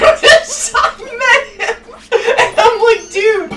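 high slow laughing; laughter taking over. Real, not acted. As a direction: giggle the words out. Laughing at retold prison scene.

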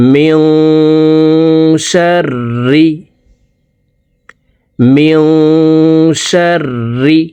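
মিং hummed through the nose before শাররি; nasal ghunna. Shown as a demonstration. Teacher demonstrating the ikhfa nasalisation. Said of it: "মিং এখানে ইকফা গুন্না".

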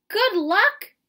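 high, loud scoffing echo; Mama mocking the boy's claim of good luck; incredulous. A narrator's reading. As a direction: throw the words back at him.

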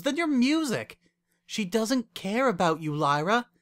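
high, emphatic, exasperated argument; heated pleading. A narrator's reading. Wendy warning Lyra about her lover, heated. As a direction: build a worked-up case against her.

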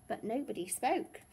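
plain reading voice

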